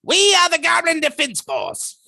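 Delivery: very high, loud goblin squeal; high, loud goblin battle cry. Shown as a demonstration. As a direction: announce it as a proud tiny goblin. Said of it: "Goblin Defense Force was more at the".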